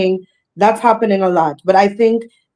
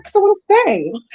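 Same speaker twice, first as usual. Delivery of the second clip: high-pitched earnest reply; 11 semitones higher than usual. Retold or imitated. Singer retelling her answer to Uncle Stretch, high and earnest. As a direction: voice lifted right up, acting out a simple answer.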